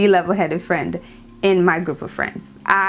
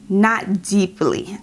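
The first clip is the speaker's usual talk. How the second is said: usual speaking voice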